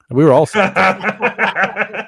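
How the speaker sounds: loud, high, slow words through laughter; helpless real laugh breaking the words; laughing retelling. Real, not acted. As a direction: crack up while telling it. Laughing at own drunk story.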